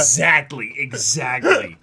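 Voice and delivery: loud, slow, drawn-out agreement; emphatic agreement. Real, not acted. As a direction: hammer it home with delight.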